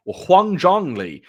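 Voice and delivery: "Huang Zhongli" said loud and slow, "Zhongli" with an "ong" vowel; slow, emphatic "Zhongli" correction; corrected name. Shown as a demonstration. Fixing his own pronunciation of the actor's name, slow and emphatic. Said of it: "I'm actually saying it incorrectly".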